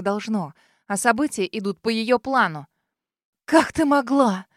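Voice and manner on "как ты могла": hoarse cry. A narrator's reading. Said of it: "хрипло выкрикиваю я".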